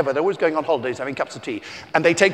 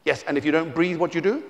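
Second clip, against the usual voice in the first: ordinary talk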